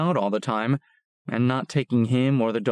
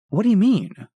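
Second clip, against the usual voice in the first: startled question; 10 semitones higher, 6 dB louder than usual. Acted. Narrator startled by Bones.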